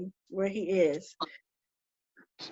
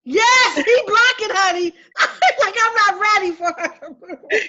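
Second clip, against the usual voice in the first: high, loud, giggling; loud, high-pitched laughing imitation; laughing tease; 10 semitones higher, 5 dB louder than usual. Real, not acted. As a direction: tease him through laughter. Teasing spirit with client, laughing.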